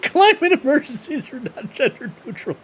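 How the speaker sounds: high-pitched laughing mimicry of an earnest speaker; mocking imitation. Host mimics the climate speaker from the clip, high laughing mockery.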